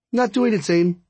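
high-pitched protest; stubborn refusal. Acted. Friend refusing Zane's swimming lesson, stubborn.

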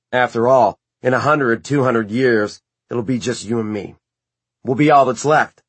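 Magnus voice. A narrator's reading. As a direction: speak as a worldly immortal. Magnus voiced, quoted earlier.

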